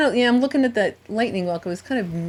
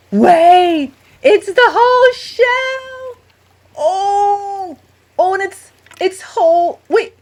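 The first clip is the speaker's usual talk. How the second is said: excited shout; 10 semitones higher, 9 dB louder, slower than usual. Real, not acted. Finds a whole shell, shouting.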